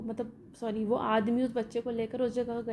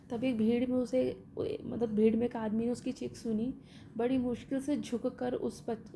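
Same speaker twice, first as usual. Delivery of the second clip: ordinary talk, nothing marked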